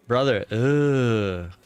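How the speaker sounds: normal talking voice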